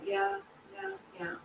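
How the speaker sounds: small, high, soft yeahs; feigned agreement. Retold or imitated. Mourner going along with people's comforting words, feigned soft agreement. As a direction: nod along softly, just to be left alone. Acts out brushing off condolences.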